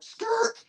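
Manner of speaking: very high screeched skid noise; imitated tire skid. Riding up on bikes, imitated skid screech. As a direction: squeal it like bike tires. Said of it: "do the little skid mark".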